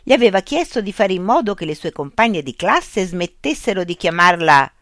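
usual speaking voice

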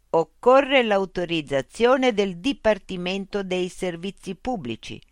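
high, loud, slow loudspeaker machine. Acted. Loudspeaker robot voice addressing Mitch. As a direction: speak as a polite, mechanical information unit.

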